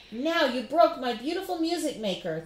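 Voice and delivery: boomed. Acted. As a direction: scold over the broken box. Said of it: "the man boomed".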